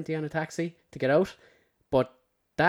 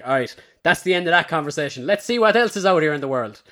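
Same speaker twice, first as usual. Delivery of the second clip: loud, bright brush-off, ending the chat; breezy brush-off; 3 semitones higher, 7 dB louder, faster than usual. Retold or imitated. Recalling how he left a dull chat, a loud cheerful brush-off. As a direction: wave it off and move on cheerfully. Self-quote, giving up on chat.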